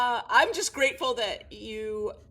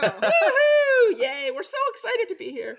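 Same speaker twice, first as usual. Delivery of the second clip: high, loud laughing cheer; excited cheer; 11 dB louder than usual. Real, not acted. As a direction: whoop with delight. Guest cheers at the welcome.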